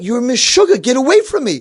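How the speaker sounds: high, loud cranky snap; angry dismissal. Retold or imitated. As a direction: brush him off as a cranky old man. Cranky man rejects the deal.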